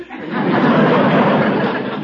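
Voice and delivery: a long, high chuckle at his own joke; long high laugh. Real, not acted. Druggist laughing at his own story, long high laugh.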